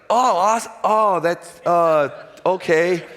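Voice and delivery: high, loud stammering surprise breaking into a laugh; stunned surprise. Retold or imitated. Player hearing from his GM he's traded, stammering high surprise. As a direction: caught off guard, fumbling for words then laughing.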